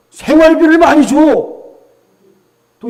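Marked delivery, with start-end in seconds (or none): 0.1-1.2 higher and louder retort from the wife; wife snapping back. Retold or imitated. Wife retorting to her husband about the side dishes, louder snap. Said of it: "부인이 또 뭐라 그래요?".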